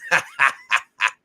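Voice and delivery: loud, punchy burst of laughter; real open ha-ha laugh; hearty laugh. Real, not acted. Laughs at his own admission.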